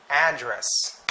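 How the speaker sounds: "address" with stress on AD; noun stress. Shown as a demonstration. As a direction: open hard on AD in "address", then fall. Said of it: "it should be".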